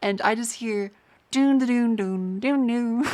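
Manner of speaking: slow sung-out imitation of a boot-up jingle; playful imitated chime, drawn out; mock startup chime. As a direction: hum it out like the old Windows startup sound. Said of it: "The fucking Windows X P boot up noise".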